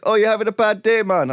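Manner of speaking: put-on a light, half-hearted Jamaican accent; mock Jamaican accent. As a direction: imitate the bracelet seller in mild Jamaican accent. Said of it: "He's Jamaican now".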